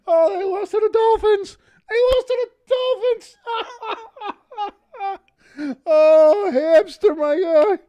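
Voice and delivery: high laughing repeat, nearly crying. Real, not acted. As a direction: wheeze it out through laughter. Laughing at rival's loss.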